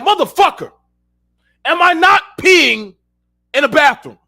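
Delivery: loud high retort, dragging the key words; exasperated yell. Retold or imitated. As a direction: shout it back in disbelief. Retold angry retort in restroom.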